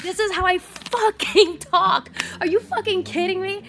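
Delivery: loud, high-pitched outraged comeback; exaggerated indignant retort. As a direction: belt it out, hugely over-emphatic and incredulous. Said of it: "it sounds exaggerated".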